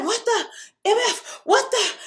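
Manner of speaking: high, stunned disbelief; shocked outburst. Retold or imitated. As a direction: blurt it out in shock. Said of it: "and I was like".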